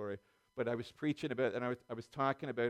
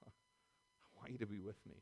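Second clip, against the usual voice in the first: whisper; 2 semitones lower, 12 dB quieter than usual. Acted. Jesus's words voiced softly.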